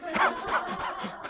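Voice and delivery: soft, high, breathy laugh. Real, not acted. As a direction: laugh quietly at your own aside. Laughs at own aside.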